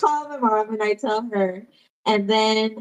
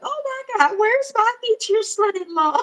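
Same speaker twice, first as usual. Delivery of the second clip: much higher, thrilled imitation of mom; mom's excited squeal; 12 semitones higher than usual. Retelling her mom's call, imitating her high thrilled voice. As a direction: squeal it like an overjoyed mother. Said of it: "But she was like".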